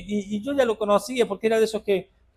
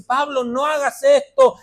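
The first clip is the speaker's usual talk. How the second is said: mentor's warning; 9 semitones higher, 9 dB louder, slower than usual. Retold or imitated. Quoting his spiritual father's warning.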